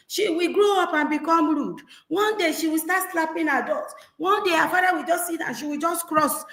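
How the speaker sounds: put-on high, scandalized neighbour tsking at a rude child; scolding onlooker. Neighbour scolding a parent over a rude girl, high and scandalized. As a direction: rise up in shocked disapproval of the child. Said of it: "some people would have said".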